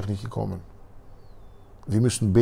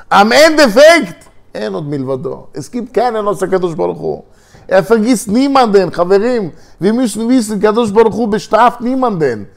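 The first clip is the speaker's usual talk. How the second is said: preaching at full force; 10 semitones higher, 8 dB louder than usual. Acted. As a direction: drive home the lesson to the audience. Sermon climax on God.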